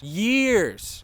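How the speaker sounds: Years punched up high and loud; emphatic repeat. Real, not acted.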